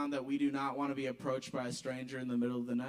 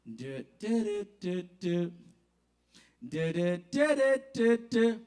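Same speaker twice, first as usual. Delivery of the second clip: beatbox; 3 semitones higher, slower than usual. Retold or imitated. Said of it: "some sixties elevator music".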